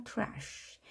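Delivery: Trash ending in a long breath of sh; s softened to sh. Shown as a demonstration. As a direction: let Trash trail into a hush. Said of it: "쓰가 쉬로 되는 겁니다".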